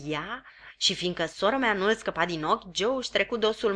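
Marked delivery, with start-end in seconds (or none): 0.0-0.4 softer, barely voiced question; just shape the word to Joe; mouthed. Pip silently mouthing to Joe behind sister's back. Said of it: "mișcându-mi buzele".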